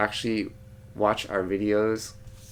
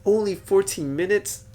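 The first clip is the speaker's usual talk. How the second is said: surprised; 10 semitones higher, 5 dB louder than usual. Real, not acted. Checking the recording time.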